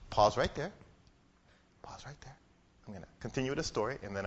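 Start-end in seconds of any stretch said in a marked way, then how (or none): 1.8-2.4 whispered repeat. Real, not acted.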